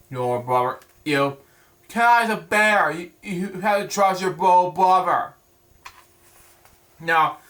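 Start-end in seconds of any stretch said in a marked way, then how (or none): none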